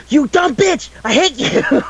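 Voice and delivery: high-pitched, exaggerated furious squeal; comic high-pitched tantrum; mock angry outburst. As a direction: hurl it out in a very high mock rage. Mock outburst of insults at [name].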